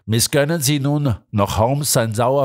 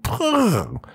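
higher, vibrating prrr like crackling wires; rolled buzzing noise; electric buzz; 13 semitones higher than usual. Acted. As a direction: mimic the hum of electric wires.

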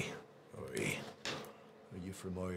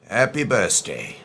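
ordinary delivery

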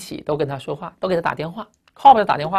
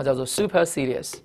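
ordinary talk

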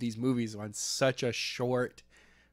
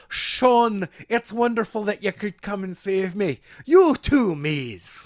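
high, hammed-up bad Irish lilt; terrible Irish accent; 6 semitones higher, 5 dB louder than usual. Irish lass thanks her rescuers in the movie, terrible high Irish accent. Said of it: "that is a terrible accent".

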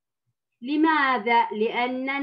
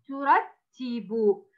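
very slow ترتب, falling; slow model reading; much slower than usual. Shown as a demonstration. Teacher repeats the word for the pupil, very slow. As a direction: read ترتب very slowly. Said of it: "كلمة".

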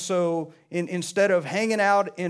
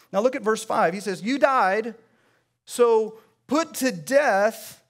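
raised, rhythmic sermon delivery with punched words; preaching; 4 semitones higher, 3 dB louder than usual. Acted. Pastor urging the congregation to change, preaching with force. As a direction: preach it to the room, lifting every line.

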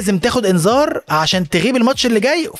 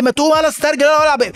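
mock taunt; 9 semitones higher, faster than usual. Teammates yelling at faker.

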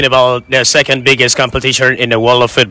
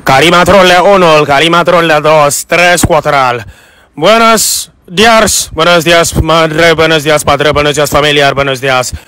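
Spanish commentary; 3 semitones higher than usual. Acted. Commentando en falso español, cada vez más emocionado. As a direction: gabble fake Spanish like a football commentator.

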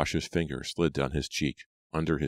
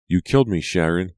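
louder, higher accusation; accusing; 4 semitones higher, 6 dB louder than usual. A narrator's reading. Scarred girl to her killer, accusing. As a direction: hurl the accusation at them. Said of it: "the girl repeated".

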